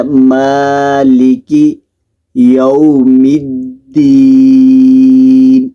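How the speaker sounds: in مالکی das Mim lang ziehen und das Kaf dünn und leicht sprechen; مالکی mit langem Mim und feinem Kaf rezitieren; Mim gedehnt, Kaf dünn. Shown as a demonstration. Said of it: "میم کو بھی لمبا کر کے پڑھنا ہے، کاف کو بریک".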